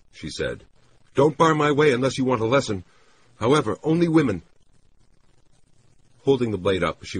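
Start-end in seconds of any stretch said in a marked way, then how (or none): 1.2-4.4 high, loud woman warning him off; high-pitched, unhinged threat; threatening woman character. Acted. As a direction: warn him away in a raised, high voice. Narrator voices woman threatening the man.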